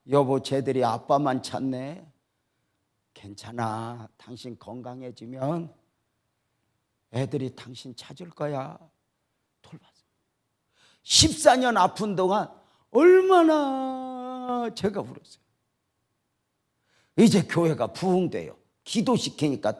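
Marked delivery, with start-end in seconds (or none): 0.1-8.8 softer, lower, consoling wife; gentle comfort. Retold or imitated. Sick wife reassures her husband about the children, softly. 9.6-10.0 breathy whisper of the words. Real, not acted. Preacher recalls caring for the family, whispered. 12.9-15.4 high, slow, breaking with emotion; tearful. Real, not acted. Preacher tells congregation of years of tears, tearful and slow.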